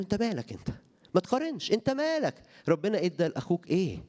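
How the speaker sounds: high, sharp rebuke with a pointed question; Jesus rebuking. Retold or imitated. Jesus rebuking Peter for comparing, high and sharp. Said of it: "قال له".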